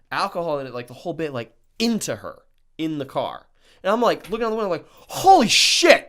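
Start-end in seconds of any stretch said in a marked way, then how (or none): 5.1-6.1 loud, high, drawn-out shocked outburst; stunned retold exclamation; shocked shout. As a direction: yell it like you just saw a crash. Sees him smash the car.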